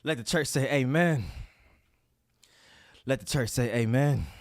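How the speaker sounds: preacher call. Retold or imitated.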